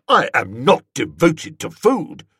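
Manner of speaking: startled, indignant protest; indignant denial. A narrator's reading. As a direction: bristle at the accusation. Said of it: "He started violently".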